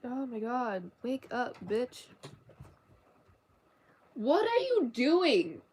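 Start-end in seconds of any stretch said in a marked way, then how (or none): 4.1-5.6 drawn-out, high swooping question; loud incredulous scold, slowly stretched; scolding a pet. Real, not acted. Scolding a cat.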